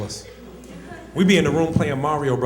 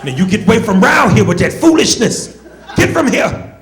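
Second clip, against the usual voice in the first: loud, high, hammed-up pleading; drawn-out parody of a frightened slave; scared slave from Roots; 4 semitones higher, 8 dB louder, slower than usual. Scared boy begging his friend to leave, mock slave from Roots. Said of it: "like a slave from Roots".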